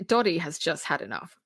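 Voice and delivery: ordinary talk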